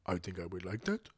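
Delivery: low, soft, halting attempt at a French accent; badly faked French accent, very quiet and deep. French ally hesitantly answering the colonist, fumbled French accent. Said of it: "Is that my French voice?".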